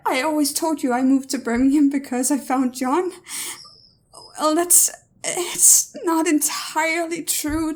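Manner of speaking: tearful confession. Acted. Daughter confessing in tears.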